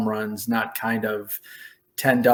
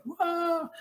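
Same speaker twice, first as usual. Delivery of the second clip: very high, soft-edged cry of amazement; awed whoa; 4 dB quieter than usual. Retold or imitated. Rookie hearing his name announced, high mock awe. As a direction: let it float way up, overwhelmed.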